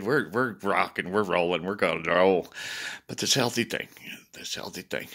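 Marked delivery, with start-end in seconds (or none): 4.3-5.1 whispered echo. Real, not acted. Muttering to Deb about the healthy food he dreads, whispered. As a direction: drop to a breathy whisper on the repeat.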